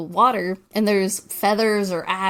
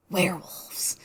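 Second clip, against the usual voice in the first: hushed whisper; 7 dB quieter, slower than usual. Retold or imitated. Rhonda identifying the howling to Chip, hushed whisper. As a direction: whisper it as an ominous realization.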